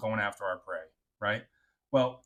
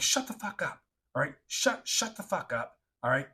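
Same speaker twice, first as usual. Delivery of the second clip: urgent angry order; 3 semitones higher than usual. Retold or imitated. Urgent order during silent patrol.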